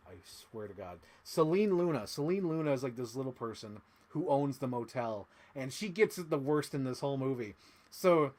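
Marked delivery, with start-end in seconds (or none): none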